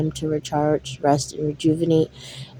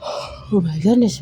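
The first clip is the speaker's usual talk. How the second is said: sigh after yawn; 3 semitones higher than usual. Real, not acted. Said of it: "I'm yawning so much".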